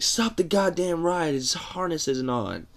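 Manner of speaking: high, urgent shout that settles; friend yelling. Retold or imitated. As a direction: yell for the ride to stop, then explain. Friend yells to stop ride.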